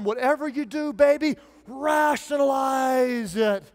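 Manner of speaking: mock hearty shout of encouragement; sarcastic rally cry. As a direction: cheer them on, dripping sarcasm. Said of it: "being sarcastic and saying the opposite".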